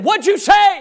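sharp high shout of annoyance; irritated yell. Retold or imitated. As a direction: yell it back, fed up kid. Angry boy snaps at teacher.

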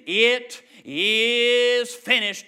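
slow last words. Acted. Jesus's dying words, slowed.

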